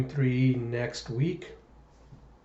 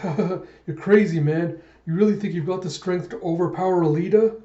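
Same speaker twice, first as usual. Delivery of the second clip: high, loud jeering taunt; acted scornful disbelief; mocking laughter; 5 semitones higher, 5 dB louder than usual. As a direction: laugh in his face, then taunt. Guy laughs mockingly at Zapan.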